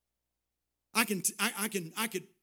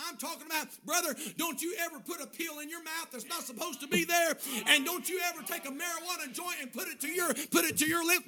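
high-pitched, insistent preacher's warning; full-force preaching; 10 semitones higher, 4 dB louder than usual. Real, not acted. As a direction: urge them hard, building louder.

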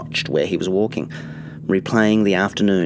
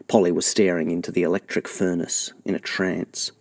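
plain reading voice

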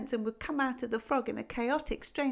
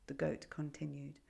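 ordinary delivery

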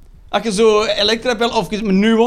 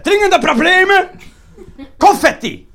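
higher, louder mock alarm over dringende problemen; mocking shout about trivial problems; sarcastic outrage; 9 semitones higher, 4 dB louder than usual. Real, not acted. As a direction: ham up the fake urgency, laughing. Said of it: "Oh, de dringende problemen".

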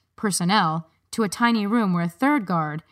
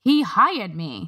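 insistent; much slower than usual. A narrator's reading. Harriet protests to guards.

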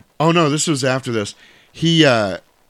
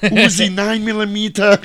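high-pitched comic action-star impression; Arnold impression; 9 semitones higher, 4 dB louder than usual. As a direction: play an exasperated Austrian action star, pitched up. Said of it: "And Arnold's like".

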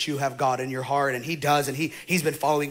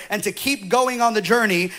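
ordinary talk, nothing marked

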